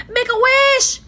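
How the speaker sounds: high, loud urgent call; urgent cry. A narrator's reading. Annie urging Jack in the shaking treehouse, loud. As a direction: cry out to him fast. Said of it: "cried Annie".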